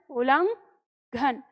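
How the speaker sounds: उलंघन stretched out very slowly, part by part; slow spelling-out. Shown as a demonstration. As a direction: draw out उलंघन syllable by syllable for the class. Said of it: "इस तरह से हमें याद रखना है". (उलंघन को बहुत धीरे, टुकड़ों में बोलें)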